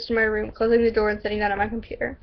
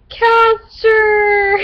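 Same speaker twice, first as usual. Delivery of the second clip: high, loud, drawn-out squeal; gushing cry; 10 semitones higher, 9 dB louder than usual. Retold or imitated. Reading out Kat's adoring comment, gushing. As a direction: gush the name long and high.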